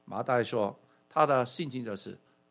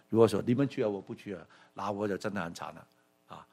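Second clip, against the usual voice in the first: ordinary talk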